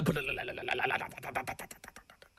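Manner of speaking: rapid, low rolling flutter noise; imitated flag flutter. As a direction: trill like big banners flapping in wind. Imitates big banners flapping in wind.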